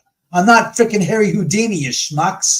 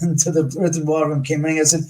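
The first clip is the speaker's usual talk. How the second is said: ordinary talk, nothing marked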